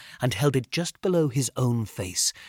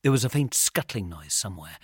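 plain reading voice

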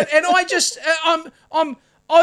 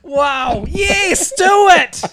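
loud mock egging on; 4 dB louder, much slower than usual. Mock cheering on farmers.

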